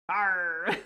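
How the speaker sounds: gravelly pirate growl. Shown as a demonstration. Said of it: "I try to do the gravelly pirate voice".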